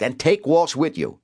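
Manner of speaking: high, forceful bark; barked order. A narrator's reading. Cobb sends detectives to fetch McLean, barking. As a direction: bark orders at your squad. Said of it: "Cobb barked".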